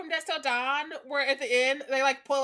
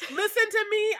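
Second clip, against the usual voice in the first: higher-pitched mocking imitation; exaggerated, indignant mimicry; mocking echo; 6 semitones higher than usual. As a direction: parrot it back in disbelief. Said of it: "listen to yourself".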